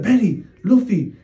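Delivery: high, loud retold call to the dogs; scared calling. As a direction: imitate a scared owner calling out.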